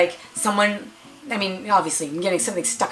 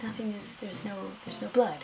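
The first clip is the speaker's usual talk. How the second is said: much softer, half to herself; surprised murmur; 3 semitones higher, 9 dB quieter than usual. Retold or imitated. Patient finds no blood on the tissue, soft puzzled murmur. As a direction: mutter it in quiet disbelief.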